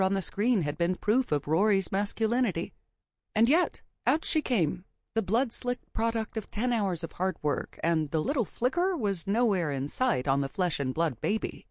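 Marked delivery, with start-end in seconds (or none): none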